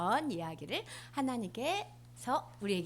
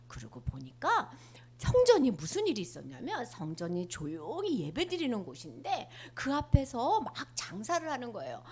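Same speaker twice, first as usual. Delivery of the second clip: plain reading voice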